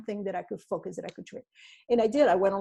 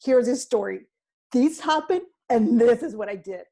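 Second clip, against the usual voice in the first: upbeat and louder, owning the story; proud storytelling; 3 semitones higher, 6 dB louder than usual. Retold or imitated. To party guests, proudly sharing what happened, bright and louder. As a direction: tell it like a triumph. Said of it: "Or you're gonna say".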